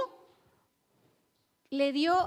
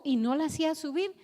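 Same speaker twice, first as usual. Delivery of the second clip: ordinary talk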